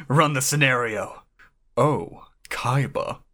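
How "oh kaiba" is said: slow, swooning mock praise; fawning flattery. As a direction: swoon over Kaiba, slowly.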